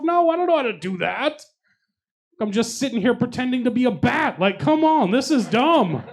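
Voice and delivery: high, loud, incredulous comic tirade; exasperated rant. Retold or imitated. Worker retelling a rant at a customer in a power outage, exasperated. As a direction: rant at high pitch, as if pushed past all patience.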